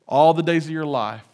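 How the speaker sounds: ordinary talk, nothing marked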